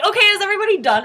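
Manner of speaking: high, loud question; hammed-up busy checking-in; brisk ajumma. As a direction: imitate an ajumma calling to the whole group. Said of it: "she was like".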